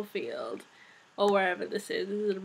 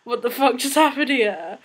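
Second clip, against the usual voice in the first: shocked disbelief; 6 dB louder than usual. Retold or imitated. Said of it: "everyone's just like".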